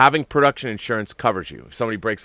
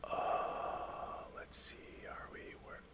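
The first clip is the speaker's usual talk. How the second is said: hushed whisper, almost no voice; 21 dB quieter, slower than usual. Real, not acted. Alone at the screen, reading the news in a whisper. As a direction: breathe it out as you read the page.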